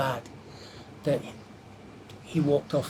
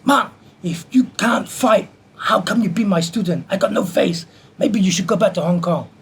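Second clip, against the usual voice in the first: high, loud telling-off, acted out; indignant raised-voice rebuke; imitated angry scolding; 5 semitones higher, 6 dB louder than usual. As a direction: imitate a master berating his student in front of everybody. Master berates student before everybody.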